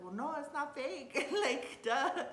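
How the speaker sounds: high, loud, laughing through it; laughing tease. Retold or imitated. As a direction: giggle out the duh. Teasing wife, laughing.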